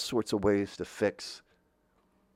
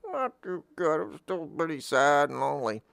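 mopey reply; 6 semitones higher than usual. Pretend. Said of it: "how you feeling?".

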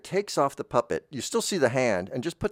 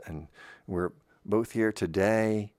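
ordinary talk

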